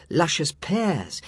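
ordinary delivery, nothing marked

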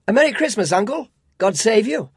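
cheerful cry. A narrator's reading. Nephew bursting in on his uncle, cheerful cry. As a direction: call out a merry greeting. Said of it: "cried a cheerful voice".

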